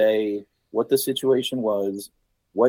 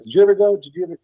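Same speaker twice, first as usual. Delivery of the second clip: excited; 8 semitones higher, 6 dB louder, much faster than usual. Retold or imitated. Retelling his eager interview questions.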